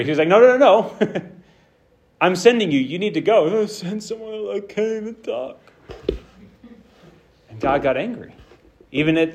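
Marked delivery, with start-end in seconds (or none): 0.3-3.4 higher, louder, insistent refusal; mock-angry God cutting off excuses. God angrily refusing Moses's excuse, raised voice. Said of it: "God actually got angry". 3.4-5.6 higher and softer, a pleading whine; whiny Moses. Retold or imitated. Moses begging God to send someone else, whiny.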